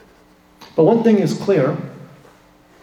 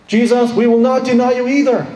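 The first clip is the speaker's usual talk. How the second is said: much higher and louder, a fervent pledge; loyal vow; 7 semitones higher, 5 dB louder than usual. Acted. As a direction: outdo Peter in loyalty. Disciples outdoing Peter's vow.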